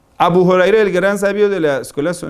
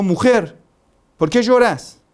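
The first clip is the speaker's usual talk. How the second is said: retold angry shout; 5 semitones higher than usual. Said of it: "Entonces gritó".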